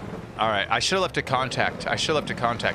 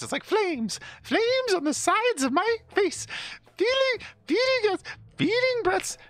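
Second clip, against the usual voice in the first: very high, strained falsetto with halting repeats; hammed-up movie quote; slower than usual. Streamer quoting a comic line about flames, high-pitched and strained. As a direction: squeal it out, stumbling over the words.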